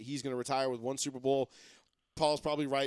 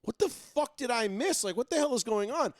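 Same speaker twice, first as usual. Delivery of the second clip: confused and a little alarmed, higher; mock disbelief; 8 semitones higher than usual. Fan seeing dozens of texts, higher and baffled. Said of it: "I'm like".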